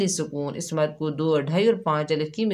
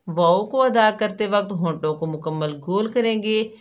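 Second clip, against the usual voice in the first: usual speaking voice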